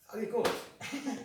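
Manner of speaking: high and soft; falsetto search. Shown as a demonstration. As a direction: muse in a high voice. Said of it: "searching for a word".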